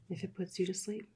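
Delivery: plain reading voice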